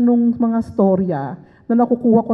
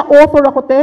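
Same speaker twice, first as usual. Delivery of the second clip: 1 proud author; 4 semitones higher, 8 dB louder than usual. Retold or imitated. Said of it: "Ang sarap sa tenga nun".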